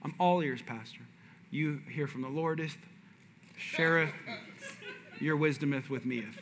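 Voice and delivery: lightly voiced pompous humble plea; a hint of King James style, soft; mock churchy old English. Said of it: "I said".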